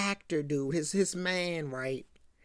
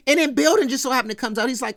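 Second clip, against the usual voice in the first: usual speaking voice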